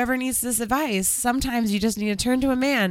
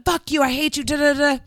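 higher-pitched pretend tirade; mock yelling; 5 semitones higher than usual. Shown as a demonstration. Showing friend the fake fight, mock yelling. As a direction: rattle off a mock angry rant. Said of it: "start yelling at him like".

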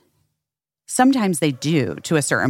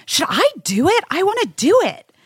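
excited. Retold or imitated. Eager quote of own earlier self.

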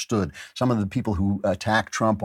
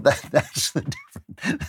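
laughing; 5 semitones higher than usual. Real, not acted. Laughs while making his point.

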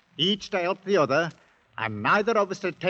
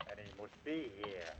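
much softer, calling from outside a door; muffled behind door; 4 semitones lower, 9 dB quieter than usual. Acted. Dravett calls to his partner from the passage, muffled behind door. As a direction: sound muffled through a closed door. Voices heard through a door.

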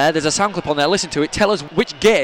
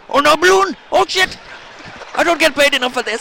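panicking steward; 11 semitones higher than usual. Acted. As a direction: squeal it like a flustered steward. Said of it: "steward panicking".

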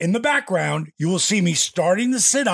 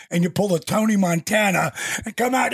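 usual speaking voice

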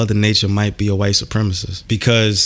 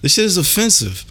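ranting; 9 semitones higher than usual. Real, not acted. Ranting about cold.

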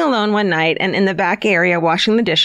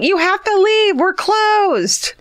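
crazy lady; 12 semitones higher, much slower than usual. Retold or imitated. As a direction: yell it like a wild woman. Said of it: "like a crazy lady".

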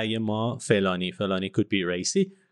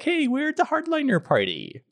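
mock party announcement. Mocking imagined party boast.

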